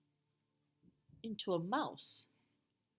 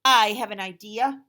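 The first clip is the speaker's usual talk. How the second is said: loud, eager dragon voice; Duncan with an idea; 17 dB louder than usual. Acted. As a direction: announce it with sudden excitement. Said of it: "said Duncan".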